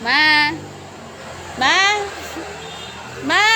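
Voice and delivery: calling out. Real, not acted. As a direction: call out to mom, rising each time. Streamer calls out to mother.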